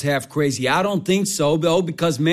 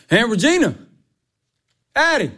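raised voice hollering through the house; noisy calling out; 4 semitones higher than usual. Acted. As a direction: holler for whoever is home. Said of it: "stop that screaming noise".

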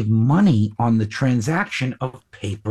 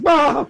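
startled scream; 13 semitones higher, 4 dB louder than usual. Retold or imitated. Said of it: "I'm like".

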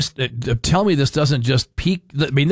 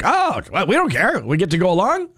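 higher, carefree mock voice; imitating radio people; 6 semitones higher than usual. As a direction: lightly act the unbothered radio crowd. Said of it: "Radio people are like".

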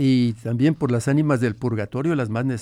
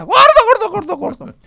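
very high, loud gobble of words; gobbling turkey; 9 dB louder than usual. Acted. The turkey, like his late wife, gobbles at him, very high and loud.

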